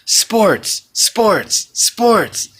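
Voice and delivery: high mock-chanted sports, sports, sports; imitating Bruce. Quoting friend Bruce's mock chant about sports. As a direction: ham up a sports-hating friend's mocking chant. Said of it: "the words of my friend Bruce".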